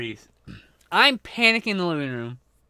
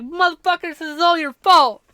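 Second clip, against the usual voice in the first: angry yell; 13 semitones higher, 7 dB louder than usual. Retold or imitated. Narrator yelling at his roommates from the bucket. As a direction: blame them at full volume. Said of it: "I'm like".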